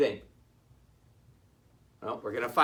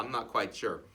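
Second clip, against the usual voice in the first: ordinary delivery, nothing marked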